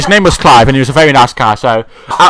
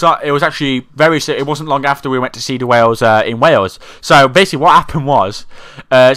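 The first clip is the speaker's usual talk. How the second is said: normal talking voice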